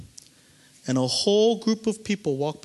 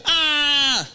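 mock cry; 11 semitones higher, 5 dB louder than usual. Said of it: "so they're crying".

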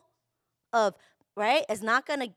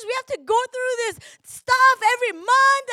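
ordinary delivery

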